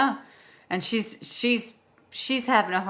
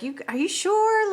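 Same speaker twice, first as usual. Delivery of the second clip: a brother asking in a much higher, startled voice; 12 semitones higher than usual. Retold or imitated. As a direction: jump up in pitch, caught off guard. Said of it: "her brother's like".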